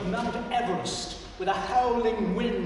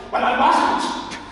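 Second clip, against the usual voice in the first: spat back; 6 semitones higher, 9 dB louder, slower than usual. Acted. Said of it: "he spat back".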